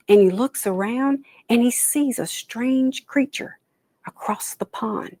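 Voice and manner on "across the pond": whispered as if spying; hushed. A narrator's reading. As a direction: breathe it like you're hiding. Spotting a strange creature.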